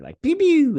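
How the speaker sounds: high loud laser gun pews; pew pew sounds. Retold or imitated. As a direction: fire off toy laser sounds. Imitating gunshots.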